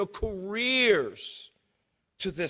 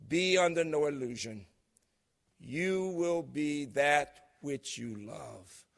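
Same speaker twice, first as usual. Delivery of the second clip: solemn recitation; 3 semitones lower than usual. Acted. A speaker closing with a philosopher's words, recited solemnly. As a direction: let each image land, fading as it goes.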